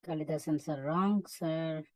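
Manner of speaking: ordinary talk, nothing marked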